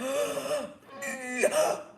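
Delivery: sharp gasp before pushing; deep breath. Shown as a demonstration. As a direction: demonstrate a big gulp of air and strain. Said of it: "I take a breath".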